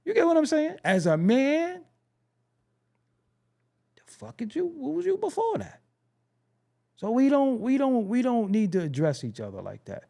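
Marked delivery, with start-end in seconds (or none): 4.0-5.8 incredulous mock question. Real, not acted.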